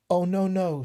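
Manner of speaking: a small voice refusing firmly; little fly. Acted.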